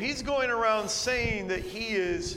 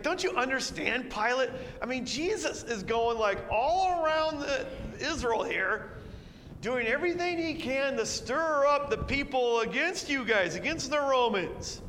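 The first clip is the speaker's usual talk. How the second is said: higher, pressing plea to Pilate; urgent accusers; 6 semitones higher than usual. Retold or imitated. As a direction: push Pilate to see the danger.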